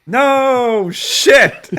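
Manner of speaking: high, booming joke outburst; loud exclamation. Real, not acted. Joking outburst about not swearing.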